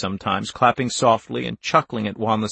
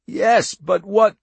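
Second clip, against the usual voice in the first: high, louder, drawn-out question; strained, pitched-up protest; anxious captive; 9 semitones higher, 5 dB louder, slower than usual. A narrator's reading. Wanless questioning the man holding him, anxious.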